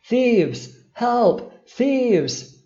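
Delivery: loud, high cries of alarm; shouting. A narrator's reading. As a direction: shout for help in short, separate bursts. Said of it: "shouted the old merchant at the top of his lungs".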